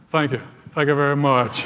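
loud, drawled [name]-style thanks; [name] impression. Priest giving in to the concierge, [name] impression. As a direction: play the King thanking his fans. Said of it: "Father Morgan looked at him and went".

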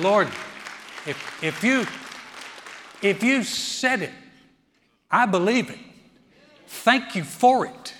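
loud, slow, lifted voice of trusting prayer; loud, slow prayer of thanks; imitating a believing prayer. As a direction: pray it like you mean every word. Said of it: "we live going".

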